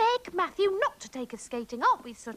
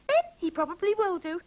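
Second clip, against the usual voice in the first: ordinary talk, nothing marked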